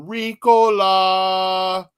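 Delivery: slow, drawn-out alpine call; Ricola yodel. Retold or imitated. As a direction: stretch it like the ad mountain men. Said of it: "the guys on top of the mountain going".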